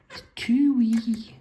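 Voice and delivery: high squeaky cartoon voice, far above his own; Whiskey and Brandy Bollins voice. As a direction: pitch it way up like a cartoon brother. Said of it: "Not a very good impression".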